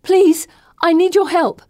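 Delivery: high, loud, pleading cry. Acted. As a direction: beg them out loud. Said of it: "I cry".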